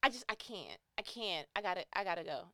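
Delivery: normal talking voice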